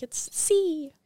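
C pitched high and drawn out; emphatic correction. Retold or imitated. Imitating guest correcting the name, emphatic high C. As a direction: stretch C high to correct someone.

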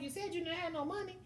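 high, pointed retort as the friend; high friend's comeback, lightly voiced; imitating her friend. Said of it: "She was like".

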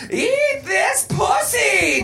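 high drawn-out shouted demand. A narrator's reading. Missus Garrett makes her crude demand, demanding. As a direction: shout it as a lewd order, high and slow. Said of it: "demanded Missus Garrett".

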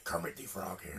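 low, soft mock Kermit greeting, laughing; mocking Vince voicing Kermit the Frog. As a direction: croak out the Muppet line as a gruff old boss. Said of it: "having Vince McMahon voice Kermit".

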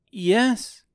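put-on high girl's answer; girl character. A narrator's reading. Girl answering the wizard, high girl voice.

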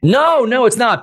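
high, loud protesting "no, no"; laughing denial. Real, not acted. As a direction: brush off the teasing. Denying being a salesperson.

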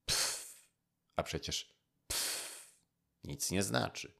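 a dismissive, breathy pff of contempt; scornful. A narrator's reading. Proud courtier scoffs at an inferior, scornful. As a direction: brush it off as beneath you. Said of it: "jeno wzgardliwie".

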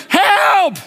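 high, comic call for help; mock cry for help. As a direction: call downstairs in exaggerated desperation. Retold shouting to wife downstairs.

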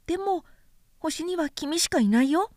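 put-on light, high boyish voice, curious; a small boy asking innocently; little prince voice. A narrator's reading. Little prince asking the vain man, high childlike voice.

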